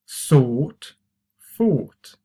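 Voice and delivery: "Sought" and "fought" with a long "aw"; drawn-out "Sought", "fought" for repeating; long vowel. Shown as a demonstration. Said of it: "Remember, it's long".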